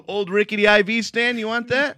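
high, teasing dealer pitch; mock salesman. Friend joining in offering an IV stand, high mock salesman. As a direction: hawk junk with a grin.

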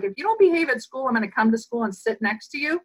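rapid mock parent warning; quick teasing parent threat; fast parental threat. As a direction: rattle off the classic threat quickly. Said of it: "you know how people say".

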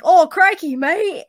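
hammed-up bad Australian accent, high and loud; Jetfire. Fan imitating Jetfire's outrageously bad accent, mock Australian. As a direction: belt out a terrible mock Aussie accent. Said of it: "in these terrible accents".

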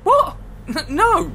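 high startled yelp; sudden disbelieving squeak; shocked. Real, not acted. Baffled by the machine.

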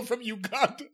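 giggling through a stunned question; laughing disbelief. Real, not acted. Cohost laughing at the absurd phone call story. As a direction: laugh the words out, can't believe it.

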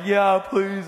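loud, high mock-crying with laughter; hammed-up tearful plea, pitched high; imitated crying. Weeping man answering the donut shop lady, imitated crying. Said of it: "crying. He's like".

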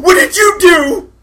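slow, high, loud shriek; screamed accusation. Acted. Gretchen faces the blue orc, screamed accusation. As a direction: scream it, drawing out the words.

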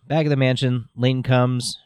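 ordinary talk, nothing marked

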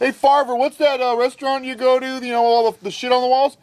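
high-pitched, loud movie-character voice; pushed-up, hammy quote delivery. Acted. Acting out Super Troopers scene.

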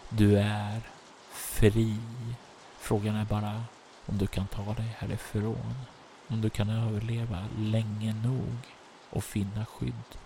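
soft narration with long pauses; phrase by phrase, hushed and suspenseful; game master's ominous narration. A narrator's reading. Game master's closing scene setting.

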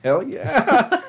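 guy answering the woman, eager and drawn out; eager yes. Retold or imitated. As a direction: act thrilled at the offer. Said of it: "I'm like".

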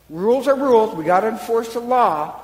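loud, high insistence on the rule; stern insistence. Retold or imitated. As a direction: hammer home the law. Jairus insisting on the law.

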